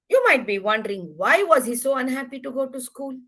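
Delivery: ordinary delivery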